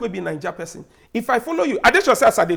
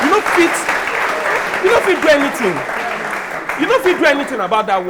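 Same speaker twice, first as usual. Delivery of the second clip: emphatic insistence; 5 semitones higher, 5 dB louder, faster than usual. Real, not acted. Insisting people stay down.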